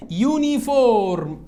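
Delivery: "يونيفورم" drawn out slowly, opening on yoo; careful model reading. Shown as a demonstration. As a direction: read "يونيفورم" slowly with a clear y-glide. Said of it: "اقرا كده".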